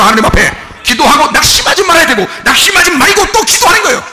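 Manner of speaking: loud, high impassioned preaching; fervent preacher's cry; full-force preaching. Acted. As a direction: preach it out to the whole congregation.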